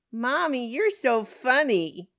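put-on high, loud, drawn-out child voice; teasing little girls. Laughing girls hugging trapped woman, teasing child voice. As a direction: stretch it out in playful child teasing.

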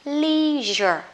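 LEISURE as "LEE-zher"; correct leisure. Shown as a demonstration. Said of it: "Thế cho nên nó sẽ đọc là". (đọc LEISURE là "li-giơ")

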